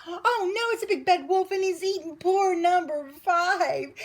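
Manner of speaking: high, loud, frantic wail; crying out in rage. A narrator's reading. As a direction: cry out in alarm as an outraged mother duck. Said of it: "she cried".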